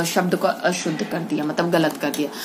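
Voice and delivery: ordinary delivery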